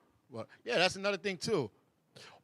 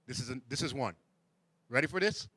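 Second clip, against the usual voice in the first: normal talking voice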